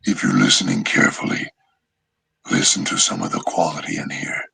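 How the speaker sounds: low, breathy demon whisper full of rasp; whispery Kurama voice. Shown as a demonstration. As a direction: hush it into a low, scraping fox-demon whisper. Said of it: "I'm hearing raspy".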